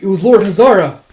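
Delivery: high, loud acted answer; urgent report. Messenger naming the traitor to the king, urgent shout.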